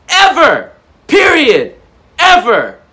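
big emphatic shout. Real, not acted. Motivational speaker shouting to his viewers about their great era. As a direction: yell each word out with full force.

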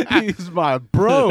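"He's my bro" said high, loud and slow through laughter; laughing. Real, not acted. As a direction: laugh your way through the punchline. Repeats punchline laughing.

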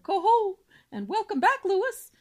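high, loud joyful shout. Acted. Whole family shouts a welcome to Lewis, loud and high. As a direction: welcome him home loudly. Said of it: "Everyone shouted".

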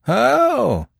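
high, loud scornful cry; defiant. A narrator's reading. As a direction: fling it back in defiance. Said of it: "cried the prisoner in defiance".

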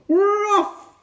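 very high pirate cheer; crew shout. A narrator's reading. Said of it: "we all shouted".

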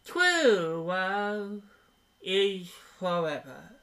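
slow, high, pompous wedding priest; drawn-out mock-solemn clergyman; Princess Bride priest. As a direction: impersonate a ceremonious priest, stretching each word. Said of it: "the priest from Princess Bride reading it".

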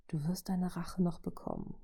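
soft, whispery promise; hushed assurance. A narrator's reading. Robot promising his ally revenge, hushed reassurance. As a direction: assure in an undertone. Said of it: "versicherte er".

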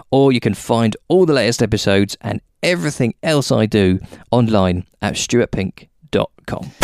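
a light ad-read voice; podcast outro. Acted. Host thanking listeners at show's end, ad read. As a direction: read the sign-off like a promo.

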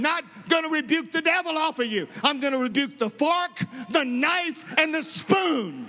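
high, ringing preacher's shout; high shouted rebuke, full preaching force; fiery preaching. Real, not acted. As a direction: thunder it from the pulpit, building to the spoon. Preacher rebuking gluttony from pulpit.

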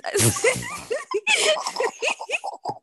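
long high-pitched laugh. Real, not acted. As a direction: break up laughing. Laughing fit.

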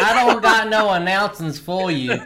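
put-on cranky old man shout; shouting at trespasser. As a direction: grumble it like an angry geezer. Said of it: "We're doing a bit".

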